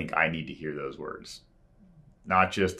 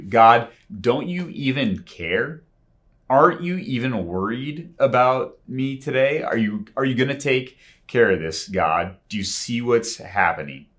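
louder, higher frustrated pleading to God; frustrated complaint; 5 semitones higher, 6 dB louder than usual. Retold or imitated. Believer complaining to God in a crisis, frustrated plea. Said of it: "Sometimes in our frustration".

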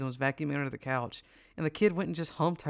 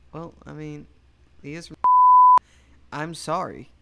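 ordinary talk

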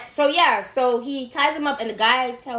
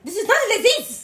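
higher, botched mock French accent; 8 semitones higher than usual. Co-host redoing the woman's line, botched French accent. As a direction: badly fake a French accent, near laughter. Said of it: "I'm fucking it up".

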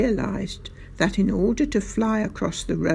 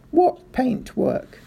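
slow, higher-pitched innocent query; feigned puzzlement; 6 semitones higher, slower than usual. A narrator's reading. Sergeant plays innocent about the paintwork, puzzled. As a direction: draw it out as if baffled.

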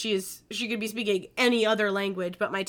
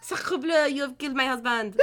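fake French accent; 3 semitones higher than usual. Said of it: "Maybe she's French".

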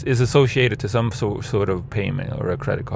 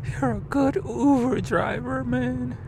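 much higher grateful mock voice; high squeaky praise, slowing down; friends' voice. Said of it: "They're like".

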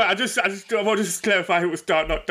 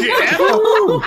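high, loud laughing yeah; 14 semitones higher, 8 dB louder than usual. Real, not acted. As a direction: squeal it through laughter. Laughing after scream.

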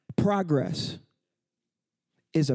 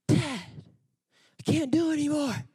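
put-on higher, fast, tired-kid whine; whiny kid; 10 semitones higher than usual. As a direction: play a worn-out little boy begging to stop. Son complaining during sit-ups.

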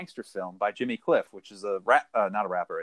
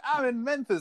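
high, excited TV host voice; playful Guy Fieri mimic; Guy Fieri impression; 11 semitones higher than usual. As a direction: pitch it up like a hyped food show host. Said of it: "be like".

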